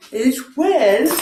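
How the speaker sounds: talking through a yawn; words stretched by a real yawn. Real, not acted. Teacher yawning while explaining yawn.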